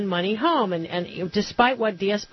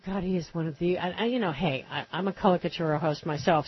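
ordinary delivery

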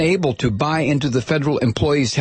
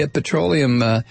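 ordinary delivery

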